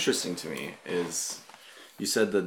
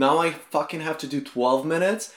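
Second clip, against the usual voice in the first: annoyed inner voice; 7 semitones higher, 5 dB louder than usual. Retold or imitated. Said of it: "And I'm like".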